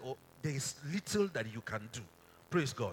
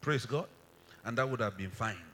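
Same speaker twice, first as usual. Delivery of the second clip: normal talking voice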